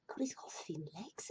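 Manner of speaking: whispered to yourself, mocking; a sly whisper about his legs; whispered aside. Real, not acted. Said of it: "whispered aside about picture".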